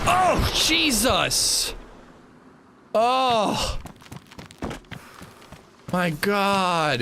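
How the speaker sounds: high, startled outcry. Real, not acted. Streamer caught out by the Steam overlay, startled outcry. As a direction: cry out as if jolted. Sudden startle during the game.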